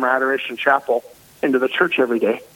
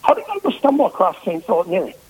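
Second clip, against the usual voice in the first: quick, higher, lightly voiced amazed listener; light mock wonder, quick and a bit higher; quoting a listener; 5 semitones higher, faster than usual. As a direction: toss it off as a delighted stranger who stumbled on the parish. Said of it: "It's like".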